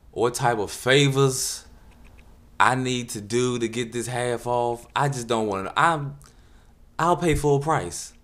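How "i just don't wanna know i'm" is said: ordinary talk, nothing marked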